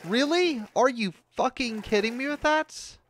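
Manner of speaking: high, loud, disbelieving rage; furious outburst. Real, not acted. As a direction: explode at the game in disbelief. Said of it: "I am very pissed".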